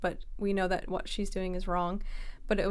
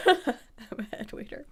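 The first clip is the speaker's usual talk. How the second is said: laughing; 3 semitones higher than usual. Retold or imitated. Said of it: "repeating the joke".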